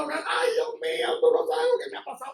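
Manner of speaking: hammed-up tearful complaint; mock crying.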